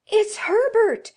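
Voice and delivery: high, very loud scream of a name. Acted. Old woman screaming that her dead son is at the door. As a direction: scream it out with wild hope. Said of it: "she screamed".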